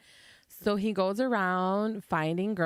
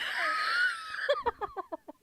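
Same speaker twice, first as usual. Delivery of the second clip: high screaming laugh; shrieking laugh; 4 dB quieter than usual. Real, not acted. Laughing hard.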